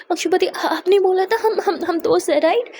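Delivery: cling to the word friends; anxious pleading. A narrator's reading. Akshu insists they are friends. (ऊँची घबराई आवाज़ में सवाल)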